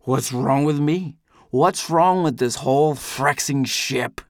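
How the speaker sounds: slow, emphatic, indignant outburst; angry retort. A narrator's reading. Quarrel over Amy and the ship, indignant. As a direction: throw the question back, angrily drawn out.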